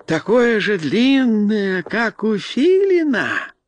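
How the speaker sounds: high, naive creature voice, slowing with wonder; joyful bearskin. A narrator's reading. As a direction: ask in a high, gleeful voice, stretching the end. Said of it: "радостно вскричала медвежья шкура". (радостно, высоким простодушным голоском)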